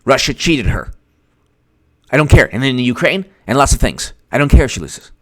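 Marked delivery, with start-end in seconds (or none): none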